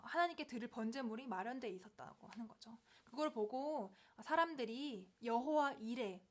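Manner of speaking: usual speaking voice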